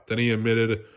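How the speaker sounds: plain reading voice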